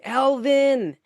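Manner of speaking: high mock yell of a name; cartoon father yelling the name; imitated father yelling. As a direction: call the name out like a cartoon dad. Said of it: "you hear the father yelling".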